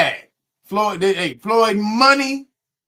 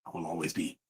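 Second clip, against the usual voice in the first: very low, hushed, lifeless statement; mock an insincere endorsement, barely audible; drained, monotone scripted delivery; Floyd Mayweather; 15 dB quieter, faster than usual. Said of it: "say it with sincerity in your voice".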